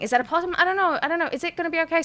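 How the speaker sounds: high-pitched rush of worried questions; freaking out. Retold or imitated. Owner fretting over cat and unknown creature, acted freak-out. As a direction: re-enact spiralling worry. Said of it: "I'm freaking out immediately".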